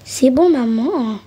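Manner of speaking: ordinary talk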